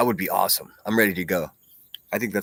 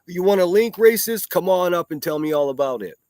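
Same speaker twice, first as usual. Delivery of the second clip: high, loud sarcastic offer; hammed-up eager host, dripping with sarcasm; mock welcome; 9 semitones higher, 4 dB louder than usual. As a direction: mockingly invite them up in a raised pitch. Mimics host welcoming racists on.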